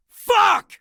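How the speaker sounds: very high, loud yell; shouted curse. Acted. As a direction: explode with one curse.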